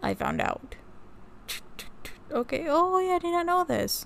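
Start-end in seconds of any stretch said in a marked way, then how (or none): 2.3-4.0 surprised. Real, not acted. Discovering a new fact.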